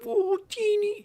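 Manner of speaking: very high, squeaky dog-talk; ridiculous pet voice. Shown as a demonstration. Owner talking to little dog Teeny, ridiculous high voice. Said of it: "go up into this ridiculous voice".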